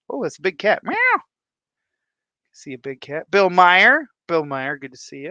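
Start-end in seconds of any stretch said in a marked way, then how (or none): none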